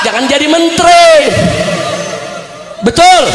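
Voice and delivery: high, forceful preacher's challenge; fiery preaching. Real, not acted. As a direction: berate an absent official before the crowd.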